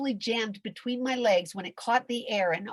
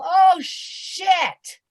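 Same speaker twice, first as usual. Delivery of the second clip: loud high shocked curse; 11 semitones higher, 11 dB louder than usual. Acted. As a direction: blurt it out in alarm. Sudden realization on waking.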